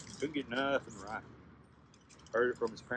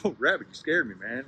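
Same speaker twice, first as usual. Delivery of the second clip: startled relief; 3 semitones higher, 10 dB louder than usual. Acted. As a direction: act out getting over a scare. Startled man scolds Rabbit.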